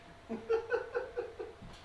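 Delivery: drawn-out villain snicker; sly demon chuckle. Acted. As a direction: chuckle knowingly to yourself. Demon chuckles at getting ahead.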